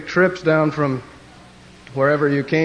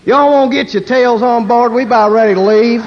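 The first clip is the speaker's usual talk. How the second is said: Texan announcer; 8 semitones higher, 8 dB louder than usual. Retold or imitated. Texas International calling passengers aboard, loud Texan accent. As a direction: holler the boarding call in Texas twang. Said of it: "then you'll have Texas International".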